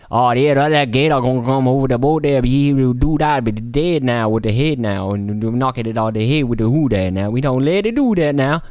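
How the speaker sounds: put-on a lightly done mumbled Cajun Gambit accent, not far from his own; half-hearted mock of Gambit's Cajun mumble. Vlogger mocking Gambit's Cajun English, lightly put on. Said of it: "that real fucked up English".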